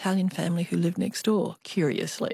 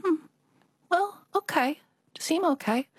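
put-on high, coy Chinese-accented girl; 10 semitones higher than usual. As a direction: shyly agree in a Chinese accent. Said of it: "I always have to do the Chinese accent".

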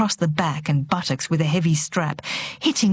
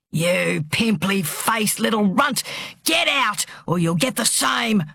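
angry bellow; 3 semitones higher than usual. A narrator's reading. Brutal father throwing his daughter's friend out, angry bellow. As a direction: shout her out with a threat.

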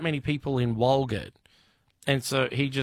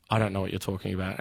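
dismissive driver; 6 semitones lower, 3 dB quieter, much faster than usual. Retold or imitated. Taxi driver brushing off dad.

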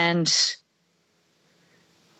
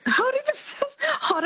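laughing. Real, not acted. Laughing echo of co-host.

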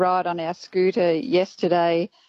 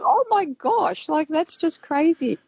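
very high, incredulous exclamation; retold astonishment; 10 semitones higher, slower than usual. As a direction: replay the disbelief in a high, stretched voice. Retold astonishment at pelican's theft.

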